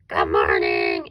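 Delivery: low, loud, rough monster growl. Shown as a demonstration. Teacher showing kindergarteners a monster greeting, monster voice. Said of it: "Say good morning in your monster voice".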